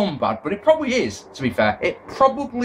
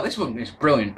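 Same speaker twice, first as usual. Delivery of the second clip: usual speaking voice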